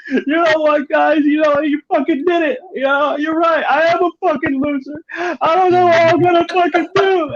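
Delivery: high-pitched blubbering imitation of a man crying; mock crying. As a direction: wail it out like a hammed-up sob. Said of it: "he's just fucking crying".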